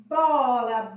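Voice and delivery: "Bola" said loud with a strong B; articulated demo. Shown as a demonstration. Teacher showing the child the mouth on "bola", loud and exaggerated. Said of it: "olha a boca".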